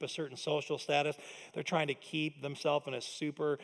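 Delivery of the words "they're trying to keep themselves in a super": ordinary talk, nothing marked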